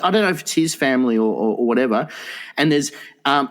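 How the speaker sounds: ordinary talk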